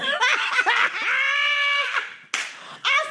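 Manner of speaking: high, shrieking burst of laughter; real, delighted cackle; big laugh. Real, not acted. Laughing at the quoted line.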